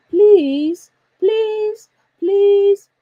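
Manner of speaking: high, loud, drawn-out pleases; mock whining plea from a powerless parent; begging. Shown as a demonstration. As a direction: beg repeatedly, stretching each please. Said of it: "it puts you in a weaker position".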